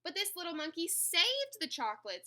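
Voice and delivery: earnest protest. A narrator's reading. Workers defend the monkey.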